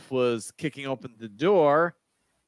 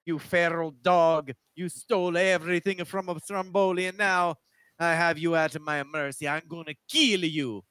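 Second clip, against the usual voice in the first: put-on Italian accent; 5 semitones higher than usual. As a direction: gloat like a cartoon Italian villain. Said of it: "that's a pretty good Italian accent".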